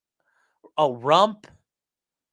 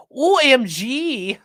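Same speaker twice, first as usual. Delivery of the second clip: letters spelled out slowly, higher and louder; spelled-out OMG; 8 semitones higher, 7 dB louder, much slower than usual. Shown as a demonstration. Streamer spelling out OMG before correcting it, slow and emphatic. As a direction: drag out O, M and G. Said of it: "actually, you have to be like".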